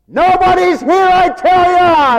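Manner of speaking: high, loud holler; excited shout. Acted. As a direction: yell it across an empty camp. Leper calls out in empty camp.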